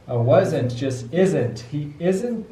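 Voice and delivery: plain reading voice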